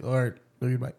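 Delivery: imitating a dad's hurried, low sign-off; quick love-you-bye. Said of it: "he does a fast love you bye".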